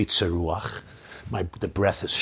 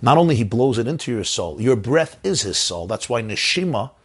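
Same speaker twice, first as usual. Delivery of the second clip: normal talking voice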